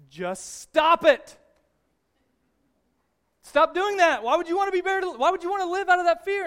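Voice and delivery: loud, high, impatient counselor yelling; retold scream. Counselor shouting his patient out of her fear. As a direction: bark it like a fed-up therapist. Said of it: "And he just screams".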